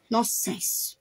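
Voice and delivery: quiet, low-pitched dismissive word; low dismissal. Real, not acted. Dismissing an accuser under the breath, quiet and low. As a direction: say it low, half to yourself.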